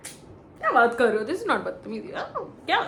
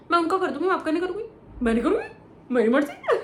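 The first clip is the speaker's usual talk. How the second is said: higher, louder mock defiance; defiant refusal; 6 semitones higher, 4 dB louder, much faster than usual. Imitating Akanksha refusing Shilpa, raised and defiant. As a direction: toss it back like a stubborn refusal. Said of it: "and आकांक्षा बोलती".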